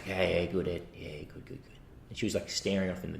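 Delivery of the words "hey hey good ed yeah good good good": spaced-out mum. Retold or imitated. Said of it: "And she's like".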